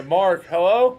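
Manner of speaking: loud, high call into the mic; calling out to be heard; to someone hard of hearing. Real, not acted. Calling to silent guest on stream.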